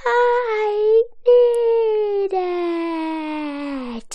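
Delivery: high, loud, drawn-out cry of need; desperate yell. Retold or imitated. SpongeBob dehydrating, yelling he needs water, desperate shout. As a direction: scream it out like you're dying of thirst. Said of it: "And then he yells".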